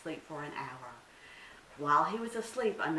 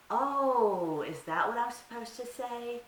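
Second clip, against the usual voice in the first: little deer voice; 6 semitones higher, 5 dB louder than usual. Acted. Deer feigns innocence.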